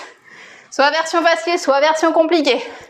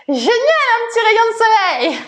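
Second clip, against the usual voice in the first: delighted; 7 semitones higher than usual. Real, not acted. Sun comes out mid-workout.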